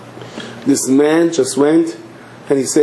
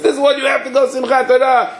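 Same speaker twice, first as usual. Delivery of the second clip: high-pitched, insistent cry; rally everyone, pitched far above normal; acted high-voiced holiday fervour; excited drunken exclaiming; 12 semitones higher than usual. Drunk seizes Torah, exhorts crowd.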